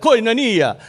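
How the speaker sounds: "Koinonia" with an Italian lilt, like Mamma Mia; "Koinonia" said like an Italian would say it; light Italian "Koinonia" with rolled vowels; Italian accent. Shown as a demonstration. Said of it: "Say it like an Italian".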